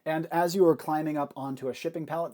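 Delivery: ordinary talk, nothing marked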